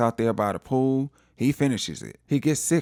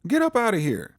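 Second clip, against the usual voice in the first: high-pitched retold order to get out; acted angry dismissal; 11 semitones higher than usual. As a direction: act out indignantly throwing someone out. Acted hotel confrontation with Rick.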